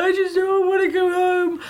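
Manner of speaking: mock tearful wail, very high; crying wail. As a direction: squeal it like a sobbing child, hammed-up. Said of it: "I cried my eyes out".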